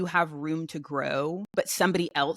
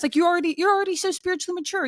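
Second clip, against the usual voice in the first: high, quick mimic of people praising her; high sing-song imitation of praise; mock flattering voice; 11 semitones higher, 3 dB louder, faster than usual. Mocking praise from others.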